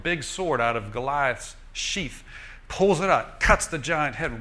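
normal talking voice

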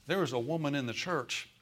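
ordinary talk, nothing marked

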